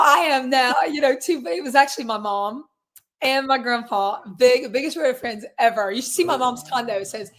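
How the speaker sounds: normal talking voice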